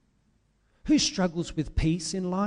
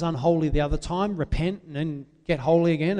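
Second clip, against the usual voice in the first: normal talking voice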